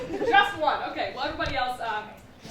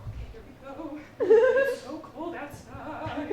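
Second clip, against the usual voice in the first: put-on freezing kid; 8 dB louder, slower than usual. Kid in freezing morning air.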